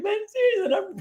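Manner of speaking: put-on very high tearful child whine; whining child. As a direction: whimper it like a jealous little girl. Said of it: "I'm like".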